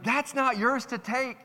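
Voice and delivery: high, slow mock outrage; indignant retold protest, drawn out high; mad customer. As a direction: imitate an angry customer scolding. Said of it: "the guy got so mad".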